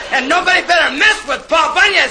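loud boastful shout. Acted. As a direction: bellow it like a threat. Shouted boast about Bunyan.

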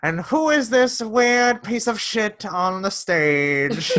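high, drawn-out stage-comic impression; Drew Dunn. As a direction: stretch it out in a high, mocking stage voice. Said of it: "I look like Drew Dunn when I do this".

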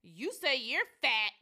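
drawn-out, rising loud jab; loud mock outburst. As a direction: blurt it out for everyone to hear. Retells loud rude public remark.

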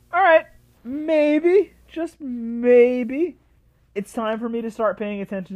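high, loud, slow drawn-out maybe; coy teaser. Real, not acted. Vlogger teasing viewers about returning to the Rangers, playful. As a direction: tease the turn like a reveal.

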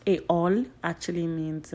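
normal talking voice

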